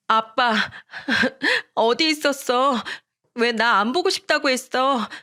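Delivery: put-on high childlike voice, sobbing as she pleads; a hurt young girl crying to her dad; sobbing little girl. A narrator's reading. Young daughter begging her father, high crying child voice. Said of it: "엉엉 울면서 이야기를 했고".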